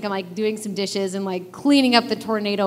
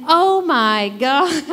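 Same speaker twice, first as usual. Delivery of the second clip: slow, high, laughing disbelief; 7 semitones higher, 4 dB louder, much slower than usual. Real, not acted. Speaker overwhelmed by God's answer, laughing. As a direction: laugh it out slowly, amazed.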